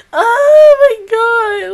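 high, slow, crying exclamation; tearful wail. Real, not acted. Fan reeling from the emotional episode, tearful wail. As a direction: drag it out, overcome and tearful.

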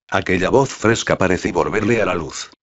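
ordinary delivery, nothing marked

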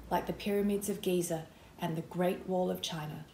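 usual speaking voice

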